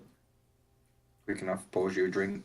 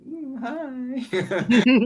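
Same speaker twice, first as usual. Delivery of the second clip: flirty lady voice; 9 dB louder than usual. Acted.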